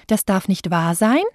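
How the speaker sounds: sein rises like a question; rising end. Shown as a demonstration. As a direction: let the voice climb on sein. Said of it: "Wenn die Stimme hinten am Satzende nach oben geht".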